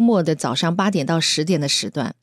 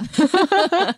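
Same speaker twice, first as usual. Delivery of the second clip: high bright laugh; 12 semitones higher than usual. Real, not acted. Laughs at own self-doubt.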